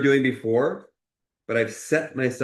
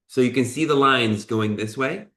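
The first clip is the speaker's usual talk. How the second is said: ordinary talk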